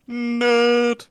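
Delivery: loud, high, drawn-out taunt; mock jeer. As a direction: jeer it at a fallen kid. Jeering at a fallen child.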